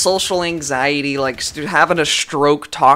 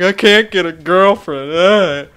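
higher, louder mock moan; mock whining; 4 semitones higher, 5 dB louder, slower than usual. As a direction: imitate a whiny complainer. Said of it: "they're bitching and complaining".

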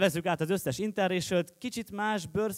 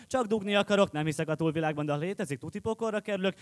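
normal talking voice

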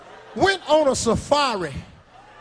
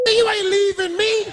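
panicked yell; 9 semitones higher than usual. Retold or imitated. Left behind on safari trail.

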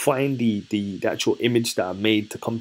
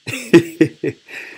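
laugh; 7 semitones higher, 4 dB louder than usual. Real, not acted. Laughing at own bad accent.

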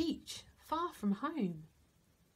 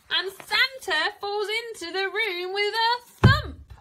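much higher, louder, excited; excited exclamation; 9 semitones higher, 11 dB louder than usual. A narrator's reading. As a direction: burst out with the big moment. Dramatic climax line.

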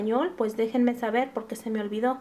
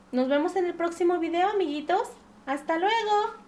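cheerful goodbye; 6 semitones higher than usual. Real, not acted. Kids channel host goodbye.

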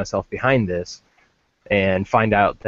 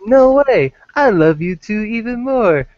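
high, loud gushing voice, easing down toward the end; Paul impression; 7 semitones higher, 4 dB louder than usual. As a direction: read it out as a loud, high-pitched put-on fan. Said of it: "is that your Paul impression".

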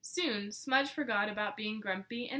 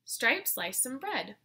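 usual speaking voice

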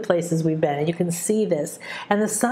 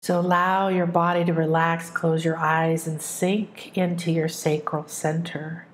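right in your ear. Acted. Leads a relaxation meditation.

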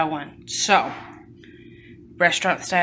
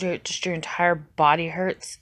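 ordinary delivery

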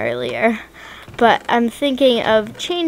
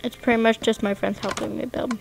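ordinary delivery

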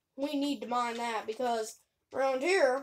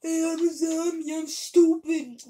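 zombie voice; 3 semitones higher, 12 dB louder than usual. Pretend. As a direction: bellow it like a dumb zombie. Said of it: "my definition of you".

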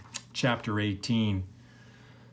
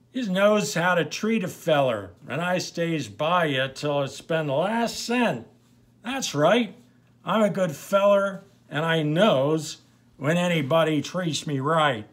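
put-on loud, high, slurring drunken swagger; drunk Pete; 6 semitones higher, 6 dB louder than usual. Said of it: "Trying to get in character here".

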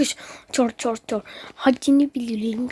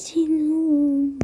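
ordinary talk

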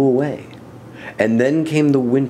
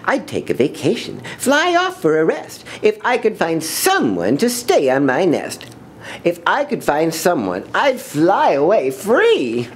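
high, airy voice of a lazy bird longing for a holiday; lazy bird Maisie; 8 semitones higher than usual. Acted. Lazy bird Maisie dreaming of a vacation, high and airy. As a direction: float it up high like a bored little bird.

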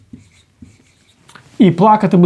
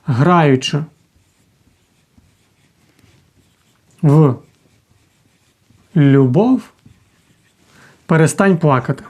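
slow word-by-word dictation with long pauses; lower, unhurried dictating voice; 3 semitones lower, slower than usual. Shown as a demonstration. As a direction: dictate it slowly so they can write it down. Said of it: "Ми собі таки записуємо".

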